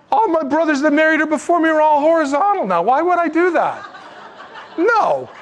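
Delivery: high, loud hammed-up refusal with laughter; incredulous laughing rant, high and loud; mock third brother. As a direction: play the scared third brother, laughing in disbelief. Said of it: "I would have said".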